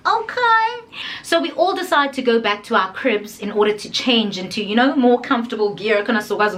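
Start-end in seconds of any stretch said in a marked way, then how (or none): 0.1-0.8 very high, singsong thrilled reply; delighted squeal. Retold or imitated. Guest told the drinks are ready, delighted squeal. Answering club owner's offer, delighted.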